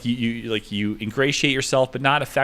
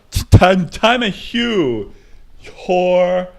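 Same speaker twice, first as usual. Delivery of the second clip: louder, slower, higher put-on voice; 8 semitones higher, 8 dB louder, much slower than usual. The same bit to the girlfriend again, bigger louder put-on voice. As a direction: push the fake voice harder. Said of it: "wrist and go like this".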